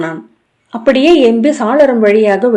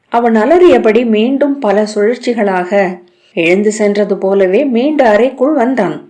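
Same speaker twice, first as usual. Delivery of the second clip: ordinary delivery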